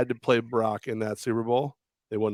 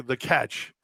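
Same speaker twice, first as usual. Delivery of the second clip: ordinary talk, nothing marked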